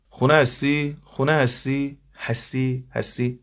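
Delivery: «خونه هستی؟» high and loud with long drawn vowels; stretched dialect vowels. Shown as a demonstration. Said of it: "vowel stretching demo".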